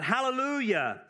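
Hallelujah thrown out high, like an exclamation point; exclamatory hallelujah. Shown as a demonstration. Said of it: "you gotta go with it".

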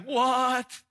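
high shocked squeak; shocked what. Retold or imitated. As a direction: gasp it out in disbelief. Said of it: "Everybody around there is like".

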